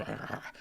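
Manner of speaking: very soft breathy gasp; a child's frozen gasp of horror; hushed shocked gasp. Retold or imitated. Child opening a closet of bodies, very soft gasp. Seeing grandfather's body as child.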